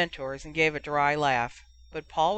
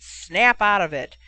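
raised, forceful telling-off; roaring; 5 semitones higher, 6 dB louder than usual. A narrator's reading. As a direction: roar at your captain to rouse him. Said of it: "he roared".

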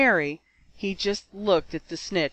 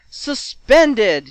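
loud, higher outburst; official's shout; 4 semitones higher, 9 dB louder than usual. A narrator's reading. Irate league official to Tim Boomer, shouted. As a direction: pronounce the penalty at a shout. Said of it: "shouted the official".

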